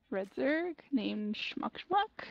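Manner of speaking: ordinary talk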